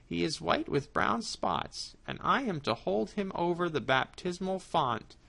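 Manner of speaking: high cat voice, sweetly lying; sly cat, coaxing. Acted. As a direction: charm the mouse into letting him go. Cat lies to mouse.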